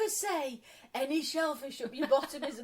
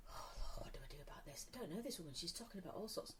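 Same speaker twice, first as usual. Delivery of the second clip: low panicked whisper to herself; 7 semitones lower, 16 dB quieter, faster than usual. Retold or imitated. Young guest panicking inside as the host overshares, whispering. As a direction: whisper it, much softer.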